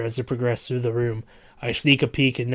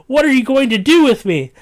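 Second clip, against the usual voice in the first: high, loud terrified yell; frightened scream; 12 semitones higher, 10 dB louder than usual. Acted. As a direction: yell in terror. Captive screaming at guards.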